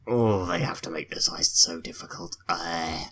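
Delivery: whispered grumble under the breath; breathy whispered moan at the game; frustrated whisper. Real, not acted. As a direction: complain in a whisper. Frustrated muttering during the heist.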